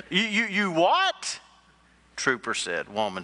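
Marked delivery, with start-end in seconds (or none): 0.1-1.4 high, stuttering disbelief with a stretched last word; comic shock, pitch shooting way up; flabbergasted stammer. Acted. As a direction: stammer it out like a dumbfounded trooper. Trooper hears of hacked-up owner.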